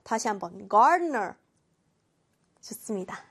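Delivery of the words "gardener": gardener with both R's curled; rolled R sounds. Shown as a demonstration. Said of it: "막 굴리는 발음". (gardener의 R을 굴리는 발음)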